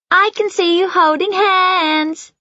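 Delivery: put-on high, slow sing-song little girl; sing-song child voice. A narrator's reading. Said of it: "in a sing-song voice".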